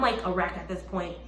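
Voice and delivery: ordinary delivery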